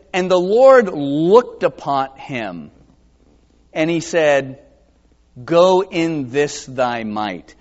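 slow solemn verse reading; solemn scripture reading. Acted. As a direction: let each word land.

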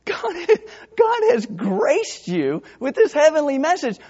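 high, mock outraged rebuke; indignant Gabriel. Gabriel rebuking Zechariah for doubting, mock indignant. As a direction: scold him with a laugh in it. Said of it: "almost like Gabriel is saying".

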